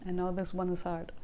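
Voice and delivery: usual speaking voice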